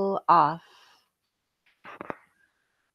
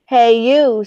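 grouchy shout; 4 semitones higher, 13 dB louder than usual. Acted. Grouchy ladybug picks a fight.

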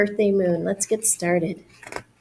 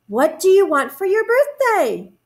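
high, eager bear voice; storybook Bear; 9 semitones higher, 4 dB louder than usual. A narrator's reading. Bear asks the moon about a present, high character voice. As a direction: ask keenly with lifted pitch. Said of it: "asked Bear".

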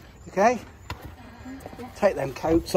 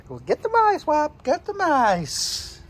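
coaxing; 9 semitones higher, slower than usual. Real, not acted. Urging the dog.

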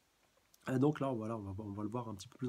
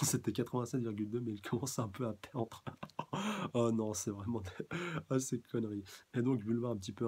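normal talking voice

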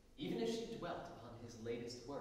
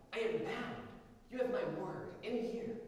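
higher, louder earnest cry; cried out; 9 semitones higher, 5 dB louder than usual. A narrator's reading. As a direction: insist she is bound. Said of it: "she cried".

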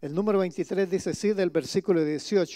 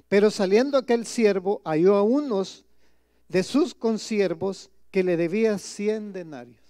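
scripture reading; 4 dB louder than usual. Acted. Pastor reading the parable aloud to his congregation, solemn and loud. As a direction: proclaim the verse to the congregation.

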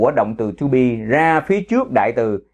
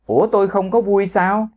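a higher, taken-aback "Ủa"; surprised retort; 6 semitones higher than usual. Shown as a demonstration. Teacher acts out protesting a friend's remark, raised pitch, surprised. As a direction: push back with mock disbelief. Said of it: "Cái mình hỏi ngược lại".

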